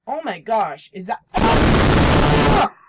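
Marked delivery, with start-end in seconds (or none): 1.4-2.8 slow then a sudden loud cry; startled scream. Real, not acted. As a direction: get caught by the scare. Jump scare hits.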